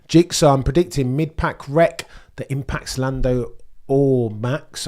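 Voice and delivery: normal talking voice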